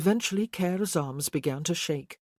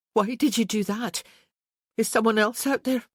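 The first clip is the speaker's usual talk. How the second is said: alarmed woman's questions, raised pitch; anxious woman; 7 semitones higher, 4 dB louder than usual. Acted. Isabel anxiously asks Care about the danger, alarmed. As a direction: she fears what lurks outside.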